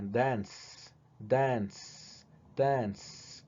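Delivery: soft "Dance" with a hissing ending; repeated softly. Shown as a demonstration. Teacher letting students hear the ending. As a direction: say "Dance" quietly, three times. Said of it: "Escuchen con qué sonido termina".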